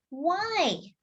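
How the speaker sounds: small, high, soft question; innocent toddler. Acted. Toddler asks his sister why, high and small. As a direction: ask it wide-eyed and innocent.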